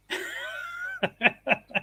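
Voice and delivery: high-pitched laugh. Real, not acted. Man laughing at his own singing story, high laugh. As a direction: break into high wheezy laughter.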